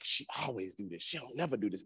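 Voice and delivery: very quiet, low inner muttering; private grumble. Retold or imitated. Husband mad at wife in his head, hushed grumble. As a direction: grumble it softly under the breath. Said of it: "I just keep it in my mind".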